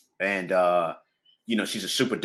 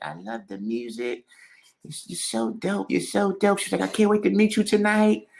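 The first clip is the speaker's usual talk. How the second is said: [name] impression; 8 semitones higher than usual. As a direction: gush sweetly in her tiny voice. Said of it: "cracking me up with this voice".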